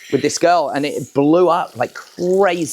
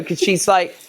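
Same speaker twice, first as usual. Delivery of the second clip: ordinary talk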